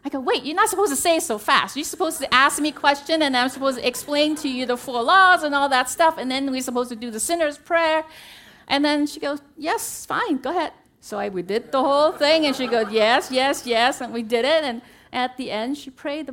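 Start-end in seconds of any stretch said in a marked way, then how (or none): 0.2-8.0 loud, high-pitched, flustered objection; mock protest. Daughter objecting to mother's too-quick yes, comic flustered protest. 9.6-10.7 impatient agreement. Retold or imitated. Mother brushes off the protest. 12.9-14.0 eager agreement. Retold or imitated. Mother agrees to every step.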